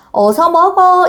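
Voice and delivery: a hint of a hurrying mom; mother urging. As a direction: urge a dawdling child to eat. Mother urging child to eat.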